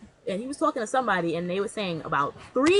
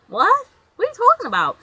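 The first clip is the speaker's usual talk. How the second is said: confused what; 13 semitones higher, 9 dB louder than usual. Retold or imitated. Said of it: "I was like".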